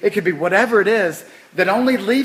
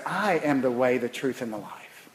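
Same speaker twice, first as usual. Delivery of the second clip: quiet quote; 4 semitones lower, 5 dB quieter than usual. Retold or imitated. Quoting Christ, first softer take.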